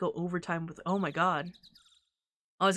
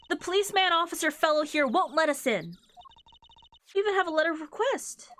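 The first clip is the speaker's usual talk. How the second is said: put-on higher, bright-eyed young girl; higher cheerful girl voice; perky girl voice; 8 semitones higher, faster than usual. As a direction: sweetly insist you're investigating. Voices girl character again.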